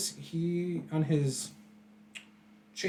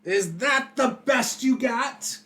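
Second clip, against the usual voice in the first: high, slow raised-voice challenge; defiant taunt; 9 semitones higher, 3 dB louder than usual. Acted. Villain shrugging off a sword hit, defiant taunt. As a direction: goad the attacker, unfazed.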